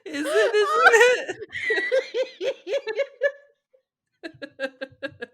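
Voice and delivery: high and loud, breaking into laughter; laughing hard. Real, not acted. Mocking her own botched line, laughing hard. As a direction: try the line, can't stop laughing.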